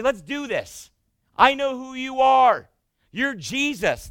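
loud, slow taunt. Acted. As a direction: call him out to claim power. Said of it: "a taunting tactic".

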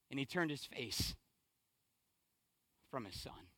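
very soft and low, with long pauses; hushed, solemn preaching. Real, not acted. Preacher sharing his dad's words about hurting children, hushed and solemn. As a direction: let the voice sink almost to nothing, weighing each phrase. Hushed, grave passage of the sermon.

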